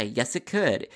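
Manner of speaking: plain reading voice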